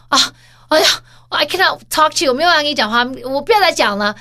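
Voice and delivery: huffy partner snapping on the phone; angry partner. Retold or imitated. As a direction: mimic a partner cutting off a phone fight.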